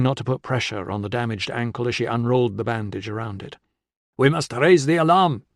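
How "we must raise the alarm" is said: insistent. A narrator's reading. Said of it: "he insisted".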